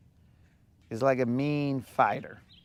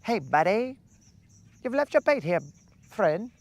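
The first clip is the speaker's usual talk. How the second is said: high, chirpy mock-polite call; friendly fish voice; 7 semitones higher than usual. Angler voices a fish returning his bait, high and mock-friendly. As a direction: play the fish handing back the bait.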